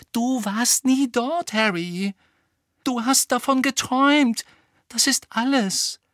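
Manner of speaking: high, emphatic interruption; insistent contradiction. A narrator's reading. Hermine tells Harry he only dreamt it, insistently. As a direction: cut in and press every point.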